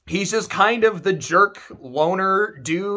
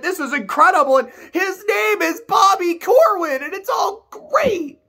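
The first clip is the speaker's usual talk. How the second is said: high, rising rave; gushing excitement; 11 semitones higher than usual. Real, not acted. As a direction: gush like a delighted fan.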